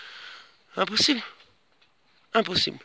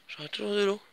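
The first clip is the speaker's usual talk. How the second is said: normal talking voice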